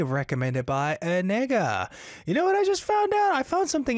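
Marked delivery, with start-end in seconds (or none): 2.2-4.0 sudden high burst, quick; eager big jump up in pitch; excited. Real, not acted. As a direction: blurt out a discovery. Host suddenly shares a discovery.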